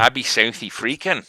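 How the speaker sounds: South African accent drifting Irish; a South African accent that slides into Irish. Shown as a demonstration. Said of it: "Why am I turning into an Irishman?".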